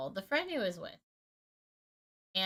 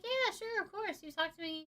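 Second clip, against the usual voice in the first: higher, softer, sugary go-along voice; fake sweet agreement; 9 semitones higher, 6 dB quieter than usual. To the guy asking to stay friends, high soft fake sweetness. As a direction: humour him with a high, soft yes.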